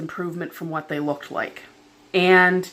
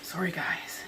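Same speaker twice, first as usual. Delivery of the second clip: soft unvoiced whisper to viewers; whispered apology; 2 semitones lower, 14 dB quieter than usual. Real, not acted.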